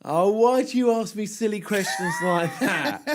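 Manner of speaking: high-pitched, laughing protest; mock exasperation. Real, not acted. Co-host asked which animal the podcast is, laughing exasperation.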